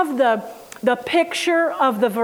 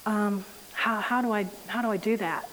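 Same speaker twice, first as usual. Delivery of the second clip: put-on much softer, lower, hesitant girl; a puzzled child asking quietly; small confused voice, hushed; confused little girl; 6 semitones lower, 11 dB quieter than usual. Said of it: "And she goes".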